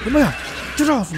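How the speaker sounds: high, loud alarm; real flustered outburst; panicked yell. Real, not acted. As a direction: shout to shake off an attacker. Grabbed by enemy.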